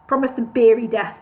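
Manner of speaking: usual speaking voice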